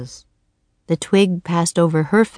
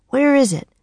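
lightly voiced boy, anxious question; worried boy asking; Jack's voice; 8 semitones higher than usual. Jack asking Annie for the diamond, anxious. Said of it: "said Jack".

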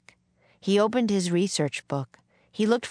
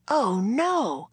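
higher, dismayed exclamation; dismayed cry; 6 semitones higher than usual. A narrator's reading. Jack learns the books will burn, dismayed cry. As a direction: cry out in dismay, without much volume. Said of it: "cried Jack".

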